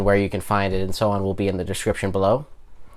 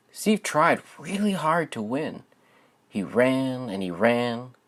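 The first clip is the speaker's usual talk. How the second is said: gentle, expressive storybook narration; lilting read-aloud voice for young listeners; reading to children. Acted. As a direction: read it like a bedtime picture book for kids. Reads picture book aloud.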